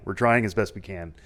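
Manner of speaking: ordinary talk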